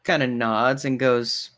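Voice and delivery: ordinary delivery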